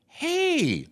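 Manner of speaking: high, bright sudden hey; as if it only just occurred to him; sudden realization. Retold or imitated. Intern at dinner suddenly thinking of something, high surprised hey. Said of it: "like it's just occurred to him".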